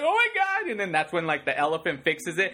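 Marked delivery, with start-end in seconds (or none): 0.0-0.7 high, startled "oh my God"; startled gasp. Retold or imitated. Audience member startled by the 3D stab, high and alarmed. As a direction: jump back like the blade hits your face. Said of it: "you're like".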